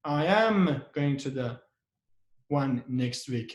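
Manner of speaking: am lifted, the rest falling away; emphatic am, then ease off; stress on am. Shown as a demonstration. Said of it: "We put a stress on the am".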